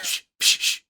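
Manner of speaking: imitated unvoiced shush; sharp little "shh" to silence someone; hushing. Imitating an off-camera actor shushing another, hushing.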